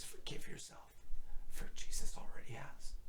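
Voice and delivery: soft breathy retold whisper; hushed, tender pastor whisper. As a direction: whisper it like a secret in his ear. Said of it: "he whispered in my ear".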